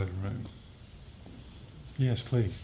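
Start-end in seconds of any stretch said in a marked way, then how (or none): none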